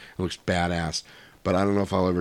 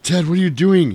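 angry husband; 10 semitones higher, 7 dB louder than usual. Retold or imitated. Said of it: "he's like".

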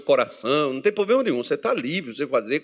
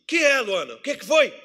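raised, high-pitched challenge; confronto encenado; 9 semitones higher, 4 dB louder than usual. Retold or imitated. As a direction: demand an answer, louder and higher. Acted snappy challenge to Luana. (cobrança encenada, em voz alta e aguda)

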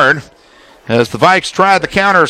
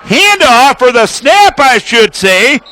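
excited call; 7 semitones higher than usual. Real, not acted. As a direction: burst out as the punt gets blocked. Blocked punt, live call.

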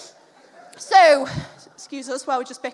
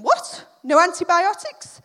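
raised, louder incredulous questions; startled disbelief; 3 semitones higher, 8 dB louder than usual. Retold or imitated. Mum's startled thought at the dentist, incredulous. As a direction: react as if you can't believe it.

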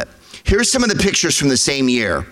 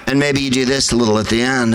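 ordinary talk

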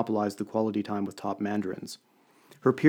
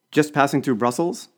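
polite question, raised a little in pitch and volume; strained politeness; 4 semitones higher, 5 dB louder than usual. A narrator's reading. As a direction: ask politely, forcing a smile. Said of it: "trying to smile politely".

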